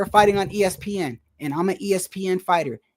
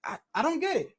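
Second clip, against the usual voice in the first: mock baffled commentator; 7 semitones higher, 8 dB quieter, faster than usual. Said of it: "the one guy was like".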